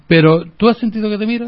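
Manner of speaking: talking with food in mouth; mouth full. Real, not acted. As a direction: speak through a mouthful. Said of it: "No se habla con la boca llena".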